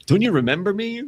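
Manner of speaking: high, swooping mock plea; visiting auntie. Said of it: "Imagine having a relative turn up".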